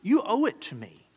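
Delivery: imitating a pushy customer who feels owed; entitled demand. Preacher mimics an entitled customer demanding his due. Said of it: "this guy had this entitlement".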